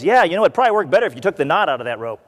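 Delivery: rattled off fast and casual; quick offhand aside. Retold or imitated. The engineer casually advising his executioners, fast offhand aside. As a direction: blurt the helpful tip without thinking.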